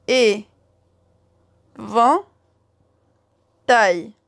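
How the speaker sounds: "Et. Vent. Taille." as three loud, separate syllables; syllabes martelées. Shown as a demonstration. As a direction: punch out "Et. Vent. Taille." with long pauses. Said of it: "Trois syllabes". (« Et. Vent. Taille. » fort, chaque syllabe isolée)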